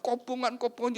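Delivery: high-pitched mock fire alarm; demonstrated urgent high cry. Shown as a demonstration. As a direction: raise the pitch as if calling out a fire. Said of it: "ya teriak".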